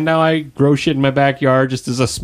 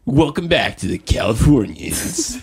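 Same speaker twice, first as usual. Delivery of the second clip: Californians SNL sketch; 2 semitones higher than usual. Acted. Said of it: "are you talking about the Californian".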